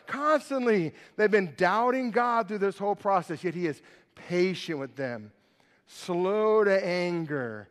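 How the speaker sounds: ordinary talk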